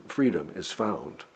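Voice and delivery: normal talking voice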